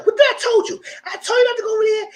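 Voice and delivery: high, loud mock telling-off; retold scolding. Retold scolding after a slap.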